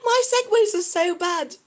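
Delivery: high, giggly confession; real laughing. Real, not acted. Streamer mocking own segues to viewers, laughing. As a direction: squeeze the words out through laughter.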